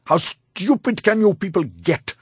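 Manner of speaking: high-pitched exasperated scolding; angry outburst. A narrator's reading. Master angrily scolds his servant.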